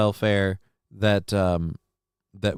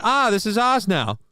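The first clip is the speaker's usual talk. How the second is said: smug colonial claim; 11 semitones higher, 4 dB louder than usual. Retold or imitated. Colonist claiming new land, in a high smug put-on voice. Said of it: "That's an Eddie Izzard bit".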